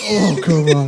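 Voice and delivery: eye-rolling exasperation. Acted. Brother joking about his urge to scoff, eye-rolling exasperation. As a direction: roll your eyes through it. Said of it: "rolled eyes, like".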